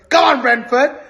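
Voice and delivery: loud cheer; cheering shout. Real, not acted. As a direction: shout it out for them. Fan cheering on rival's opponent.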